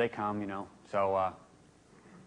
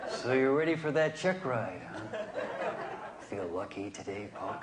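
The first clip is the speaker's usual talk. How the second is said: lightly voiced squinting gunslinger; Clint Eastwood impression; slower than usual. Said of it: "I'm turning into Clint Eastwood".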